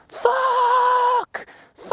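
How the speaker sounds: held-back scream, very high and drawn out; reenacted scream. Reenacting the scream after a diagnosis, strained high scream. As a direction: scream it out long, without full volume. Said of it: "I screamed".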